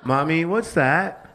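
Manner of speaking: put-on high, drawn-out child's question; child asking Mommy. Child asks mother about the running noise, curious high voice. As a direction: ask it like a curious little kid.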